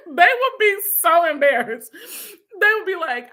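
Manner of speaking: can't stop giggling at herself; laughing. Real, not acted. Aunt laughing imagining her nephews' embarrassment.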